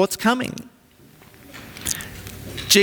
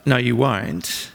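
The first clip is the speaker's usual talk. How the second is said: gentle no; 6 semitones lower, slower than usual. Retold or imitated. Jesus gently answers Peter.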